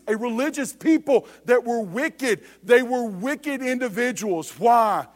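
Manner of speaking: high, punched sermon phrases; full-force preaching. Real, not acted. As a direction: hammer each point home like a fired-up preacher.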